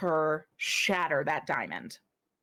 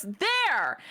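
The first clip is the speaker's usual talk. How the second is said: higher pointed call; smith's exclamation; 7 semitones higher than usual. Acted. Smith telling the player where, higher call. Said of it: "she goes".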